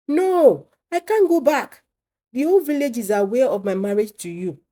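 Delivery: high, loud, pleading cry; desperate cry. A narrator's reading. New wife refuses to go back to the village, crying loudly. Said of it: "cried Sholape".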